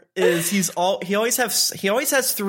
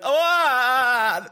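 mock scared; 6 semitones higher than usual. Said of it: "Eno just being like".

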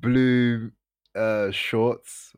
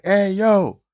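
high, loud call to someone far off; retold shout; 10 semitones higher, 9 dB louder, much slower than usual. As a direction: holler across a distance.

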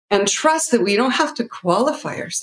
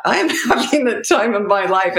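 laughing. Real, not acted. Laughing while recalling happiness.

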